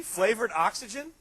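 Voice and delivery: rising, slow, disbelieving; Flavored oxygen, slowed and lifted in disbelief; incredulous mock. To the audience, mocking flavored oxygen, slow rising disbelief.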